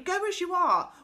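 high, loud firm urging; insistent. A narrator's reading. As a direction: cut her plan short loudly. Franny stops Connie changing.

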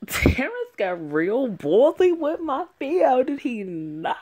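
high, loud, slow gush; thrilled fangirl squeal; excited gushing. Real, not acted. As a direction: swoon loudly over Theo. Gushing over character.

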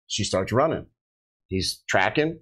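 normal talking voice

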